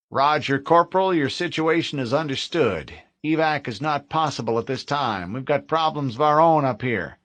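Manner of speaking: lightly voiced officer on the line; fleet radio officer. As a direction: starship officer turning down evac.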